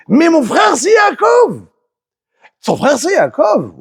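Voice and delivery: high, loud mock wail; Esav crying out. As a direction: shriek the brother's name. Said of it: "Et chacun qui crie". (Esav crie aux anges, voix aiguë et forte)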